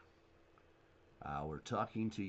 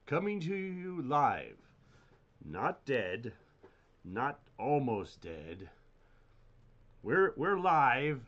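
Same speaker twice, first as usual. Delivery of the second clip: announcer voice; 3 semitones higher, 6 dB louder, slower than usual. Pretend. Announcing the live stream.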